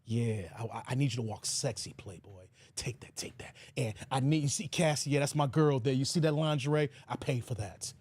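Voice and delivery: deep, low mock [name] directing; [name] impression. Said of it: "he's like".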